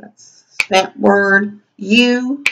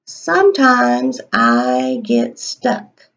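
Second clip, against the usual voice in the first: slow dictation; 8 semitones lower, much slower than usual. Shown as a demonstration. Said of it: "teacher dictating while writing".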